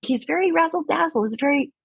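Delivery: high showy sing-song; high flashy mockery of the boss; razzle dazzle flourish. Real, not acted. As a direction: say it with sparkle.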